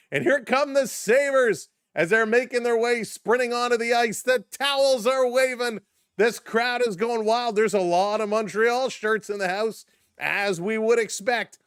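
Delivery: loud, high arena announcer call as the teams skate out; hype announcer. Acted. Host hyping the game-seven entrance to stream viewers, booming announcer call. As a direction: bring the crowd to its feet.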